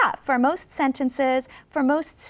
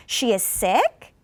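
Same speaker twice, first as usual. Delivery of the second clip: slow, "sick" rises, uncertain; unsure rising tune; much slower than usual. Shown as a demonstration. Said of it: "not sure".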